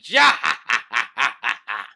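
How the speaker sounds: loud booming villain cackle; villain laugh. Acted. Hody Jones laughing at fleeing Hachi, loud villain cackle. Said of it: "Hody Jones is laughing".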